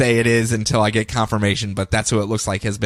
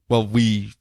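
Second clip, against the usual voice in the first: ordinary delivery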